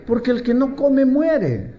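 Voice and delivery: a hint of a strict mother, slow; stern mother. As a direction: lay down the law like a mom. Mother's stern answer retold.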